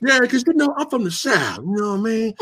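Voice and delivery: mock dubbed tough guy from the South; Steven Seagal. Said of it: "you would hear Steven Seagal go".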